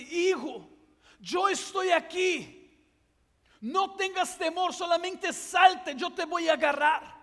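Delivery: anguished father calling up; desperate father. Acted. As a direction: beg him to jump, slow and strong. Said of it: "el papá empieza con un desespero".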